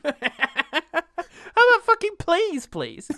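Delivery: fake-scold while cracking up; giggling hammed-up rant; laughing mock outrage. Mock angry caller.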